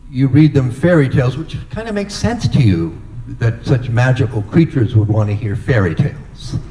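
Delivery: ordinary delivery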